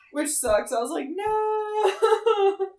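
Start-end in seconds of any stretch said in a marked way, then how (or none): 1.1-2.7 high, drawn-out cry of protest; stretched, high-pitched "no" of dismay; dismayed mock wail. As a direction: wail it in playful heartbreak. Retold reaction to characters' divorce.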